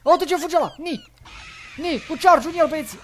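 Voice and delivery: high, loud urging; high-pitched battle excitement; excited chase call. Pretend. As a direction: yell to the pack as prey escapes. Chasing fleeing enemy in game.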